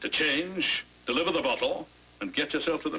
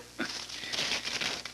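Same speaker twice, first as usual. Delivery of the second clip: soft chuckle; 7 dB quieter than usual. Acted. Inspector amused by question.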